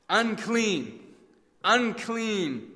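words stuck in the throat; nearly choking. Acted. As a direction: leper warning the crowd, half-choked. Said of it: "The terrible words nearly choking him".

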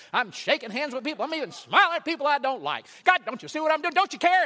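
high, loud, aggrieved venting; frustrated complaint to God. Retold or imitated. A weary churchgoer venting frustration at God. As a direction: pour out your grievance at God.

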